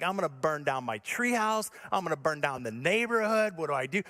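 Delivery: ordinary talk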